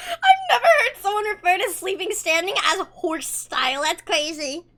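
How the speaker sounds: laughing through the words. Real, not acted. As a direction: crack up while saying it. Laughing disbelief.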